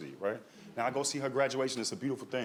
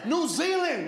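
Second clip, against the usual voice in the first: frantic uncle; 13 semitones higher, 5 dB louder, much slower than usual. Retold or imitated. Uncle panicking retold.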